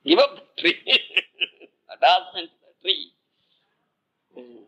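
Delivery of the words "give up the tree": laughing, high-pitched exclamation. Real, not acted. Teacher to the listeners, laughing while driving home the point. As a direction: burst out laughing as you say it.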